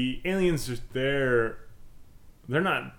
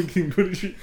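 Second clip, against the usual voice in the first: laughing; 6 semitones higher, 6 dB louder than usual. Real, not acted. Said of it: "laughing reply".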